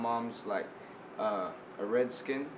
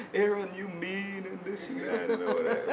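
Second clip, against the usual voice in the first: imitated reaction; 7 semitones higher than usual. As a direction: stretch it out high, imitating someone's reaction. Retelling a reaction in Creole.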